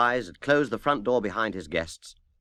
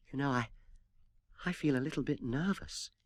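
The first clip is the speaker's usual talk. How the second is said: softer, timid admission; nervous; 3 semitones higher, 9 dB quieter than usual. Acted. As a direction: confess your nerves quietly. Tallulah admits nerves.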